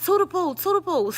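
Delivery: high, repeated nickname call. Retold or imitated. Grandma trying to rouse sleeping granddaughter, high repeated calling out.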